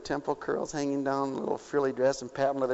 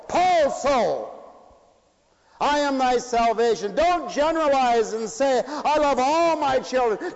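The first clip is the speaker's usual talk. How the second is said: high, loud, ringing sermon; preaching at full force; 11 semitones higher, 6 dB louder than usual. Acted. Preacher to congregation, preaching at full force.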